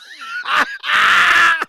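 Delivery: loud high burst of laughter. Real, not acted. Host laughing at himself mid-apology, loud laugh. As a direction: break into a sudden laugh.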